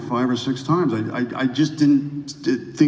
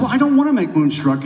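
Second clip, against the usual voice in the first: excited; 10 semitones higher, 5 dB louder, faster than usual. Retold or imitated. Retold protest to his agent.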